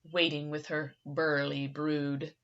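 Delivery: plain reading voice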